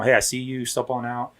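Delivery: soft, unhurried police command; super calm. Acted. Officer telling someone in the house to step out, super calm. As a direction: coax them out calmly and quietly. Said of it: "I'm, like, just super calm".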